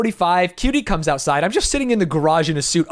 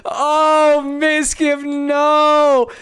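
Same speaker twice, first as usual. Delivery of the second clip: high, loud, drawn-out wail through amusement; high-pitched lament; 7 semitones higher, 4 dB louder, much slower than usual. Real, not acted. Storyteller despairing at Mizkif's behaviour, high amused wail. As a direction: wail it high, half laughing.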